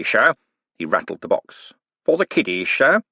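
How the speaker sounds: milk the piety for all it's worth; mock pious. Cribbins rattling the charity tin.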